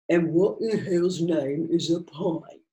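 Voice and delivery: slow, baffled barman voice; blank, unimpressed barkeep; puzzled barman. A narrator's reading. Barman answers the old man.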